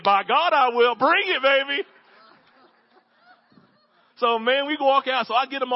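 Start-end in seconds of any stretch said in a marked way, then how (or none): 0.0-1.9 louder, pumped-up acceptance; fired-up eager yes. Retold or imitated. Pastor accepting the leader's favor, fired up and louder. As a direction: accept the mission with gusto.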